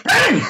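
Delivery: sharp high shouted bang; gunshot bang. Retold or imitated. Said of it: "spins around and goes".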